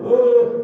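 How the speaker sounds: high, loud "Uh" of pain; mock pained wail. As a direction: imitate a whiner wailing in pain. Mimicking an excessive cry of pain.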